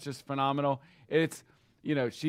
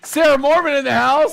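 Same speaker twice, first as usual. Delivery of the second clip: higher, louder announcer call; hype announcer; 11 semitones higher, 11 dB louder than usual. Real, not acted. Host announcing an agent like a ring announcer. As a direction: bring her in like a ring announcer.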